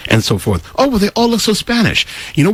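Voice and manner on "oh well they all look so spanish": mocking quote. Mocking viewers' replies.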